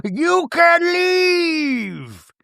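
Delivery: very slow, high, drawn-out dismissal; dismissive retort. Retold or imitated. Telling Meredith to leave, slow and high. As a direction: stretch each word out haughtily.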